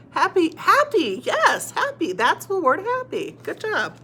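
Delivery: high, delighted teacher praise; excited discovery. Shown as a demonstration. Teacher praising a learner who got happy, excited. Celebrates working out the word.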